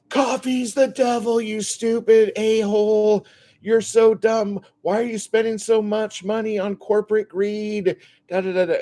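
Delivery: high, loud, mocking rant voice; hammed-up crazy guy yelling outside Starbucks; ranting street yeller. Street ranter yelling at Starbucks customers, high and loud mockery. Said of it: "he's still yelling again".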